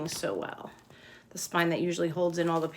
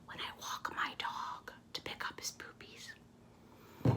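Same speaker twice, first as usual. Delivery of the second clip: hushed, secret whisper about the dog poop; whispered aside; 16 dB quieter, slower than usual. Real, not acted. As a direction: drop to a whisper like sharing a secret. Teacher confiding a gross detail.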